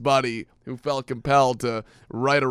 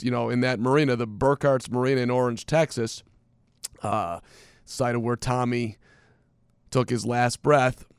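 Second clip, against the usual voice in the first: normal talking voice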